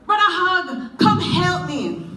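high, loud slow plea; calling out. Acted. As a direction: call down the road for help. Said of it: "So Anansi cried out".

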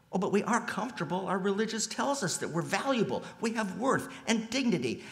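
high, quick imitation of an objecting believer; mock protest. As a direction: rattle it off high, as a comfortable congregation's objection. Voices the congregation's complacent objection.

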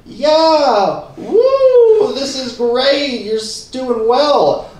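high, loud, drawn-out cheer; slow, high whooping encouragement; forced cheering. Acted. Belayer cheering the climbing birthday boy, hammed-up and drawn out.

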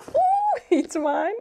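very high, loud squeal breaking into laughter; excited squeal. Real, not acted. Vlogger claiming the gift as hers, excited squeal. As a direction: squeal gleefully through a laugh. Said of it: "I'm so excited".

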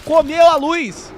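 slow, loud, high amazed cry; excited shout. Real, not acted. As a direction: stretch it out in awe. Reagindo a vídeo de Dragon Ball. (grito lento de espanto)

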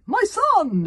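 high, loud cry of alarm; alarmed exclamation. A narrator's reading. Father seeing his son fall, crying out. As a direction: cry out for your child. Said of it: "exclaimed Baggy Bang".

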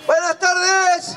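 drawn-out, high greeting call. Pretend. Puppet-show host greets the children, calling out. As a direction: stretch it out high to rouse the crowd.

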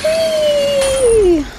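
high, loud, long whee; gleeful whee. Real, not acted. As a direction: squeal with joy on the drop. Jumping off again.

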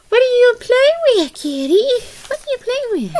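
high-pitched pet talk, sliding down at the ends; cooing to a cat. Real, not acted. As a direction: coo playfully at the kitty.

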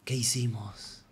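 slow, soft, stunned whisper-like question; hushed dismay. Acted. The friend waking up, asking his best friend, in soft stunned dismay. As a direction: ask it quietly, horrified at what happened. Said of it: "Él abre los ojos y dice".

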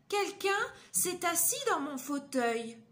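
voix de maman ours plus haute et plus forte; la maman ours s'étonne, voix haute; maman ours aiguë. Acted. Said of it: "Et Maman Ours dit". (Maman Ours découvre le chocolat goûté, voix aiguë)

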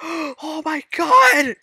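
high, loud, drawn-out gasped cry; gasp, then wail it in panic; freaking out. Real, not acted. Player freaking out over a chest, panicked cry. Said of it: "why am I freaking out?".

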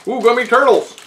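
high squealing delight; excited. Real, not acted. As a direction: squeal over the gummy turtles. Excited unveiling snack.

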